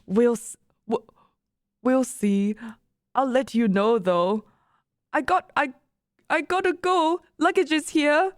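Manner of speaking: careful, badly faked German accent; halting stab at a German accent; attempted German accent. A narrator's reading. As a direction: wrap up a chat in a rough German accent. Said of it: "I can't do a German accent".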